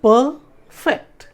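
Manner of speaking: "perfect" split by a clear pause, per ... fect; syllable breakdown. Shown as a demonstration. Teacher showing students where a word divides, syllables apart. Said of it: "अब जैसे stress कहाँ पड़ता है".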